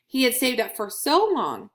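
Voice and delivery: ordinary delivery, nothing marked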